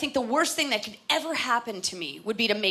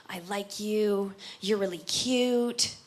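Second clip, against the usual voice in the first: tender sweet talk; 3 dB quieter, slower than usual. Retold or imitated. Lovers whispering sweet talk.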